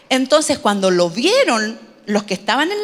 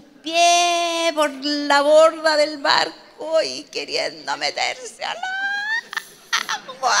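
drawn-out words, mock struggle; straining with effort; 3 semitones higher, slower than usual. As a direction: act out a wobbly climb over the side. Imita el esfuerzo de bajar. (esfuerzo exagerado, alargando las palabras)

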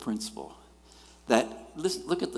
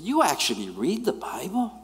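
incredulous; 7 semitones higher than usual. Retold or imitated. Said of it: "I said".

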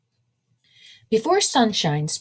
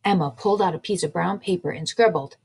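ordinary delivery

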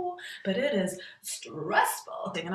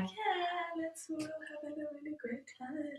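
forced cheery brightness over misery; fake cheer; 7 semitones higher, 10 dB quieter than usual. Comic telling her own fake party face, forced cheer. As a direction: pretend to have a lovely time. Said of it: "my face is just like".

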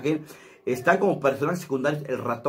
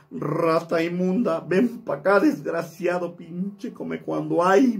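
slow, higher scolding market woman; slow, drawn-out insults from Paquita; 3 semitones higher, slower than usual. Shown as a demonstration. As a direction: insult the rat like a furious old vendor. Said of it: "Más o menos así es la voz".

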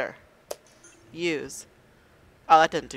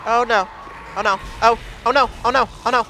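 panicked oh no; 5 semitones higher, 6 dB louder, faster than usual. Real, not acted. Panicking during a monster attack.

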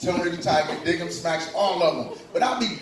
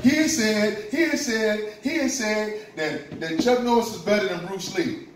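put-on higher, stammering kid voice tattling to mom; 4 semitones higher, slower than usual. Child tattling to his mom about the fight, stammering higher. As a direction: blurt it out like an upset child. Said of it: "I was like".

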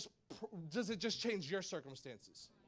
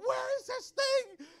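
very high, slow mock cry of alarm; imitating a panicked hunt for the puppy; frantic search; 5 dB louder, slower than usual. Searching rooms for lost puppy.

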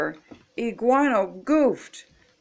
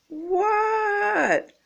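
very high, long-drawn "what"; high-pitched reply; 13 semitones higher than usual. A narrator's reading. Froggy answers the coach, squealing. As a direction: call back in a squeal.

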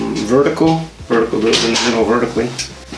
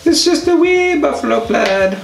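very high mock Irish lilt; high, drawn-out Irish "wee"; Irish accent. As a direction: sing it out in a playful Irish brogue. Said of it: "with an Irish accent".

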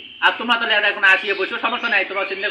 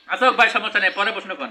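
usual speaking voice

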